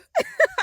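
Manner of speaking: very high crying-laughing shriek. Real, not acted. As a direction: squeal with helpless laughter. Laughing shriek after panic.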